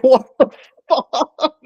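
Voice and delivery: high, loud, drawn-out mock shock; laughing disbelief. Customer told to drink while watched, laughing disbelief. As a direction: retell the shock through laughter, pitched right up. His shocked reaction to the clerk.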